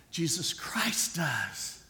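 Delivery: slow hushed whisper of the name. Real, not acted. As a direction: breathe it out, slow and secret. Repeated line, now whispered.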